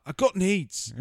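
high, loud put-on voice; hammed-up needy plea; mock needy woman. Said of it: "She's like".

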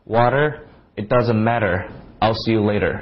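loose American flap on each t; casual American t. Shown as a demonstration. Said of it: "美国人就比较随便了".

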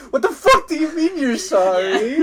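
high, loud disbelieving shout through laughter; mock outrage, laughing; incredulous laughing yell. Retold outraged reply to apology.

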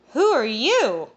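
high, loud, loopy "who are you"; a tipsy, startled question; drunken surprise. Acted. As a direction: blurt it out drunk and baffled. Drunk narrator upside down.

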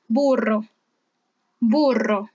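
"burro" with the RR trilled; emphatic trilled "burro"; strong Spanish R. Shown as a demonstration. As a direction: roll the double R firmly in "burro". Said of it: "La R fuerte se pronuncia".

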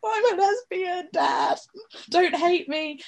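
blurted confession. Retold or imitated. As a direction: burst out with it, pleading. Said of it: "And I was like".

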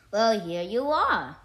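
loud, drawn-out welcome; hearty greeting. A narrator's reading. Mouse greets the cat back home, hearty. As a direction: greet them slowly and loudly.

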